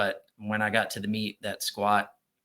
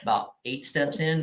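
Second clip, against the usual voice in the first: normal talking voice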